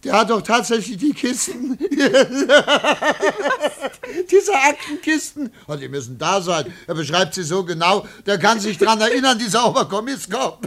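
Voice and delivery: talking high through giggles; can barely contain the joke; delighted, laughing voice; gleeful laughter. Acted. Gleeful news to secretary.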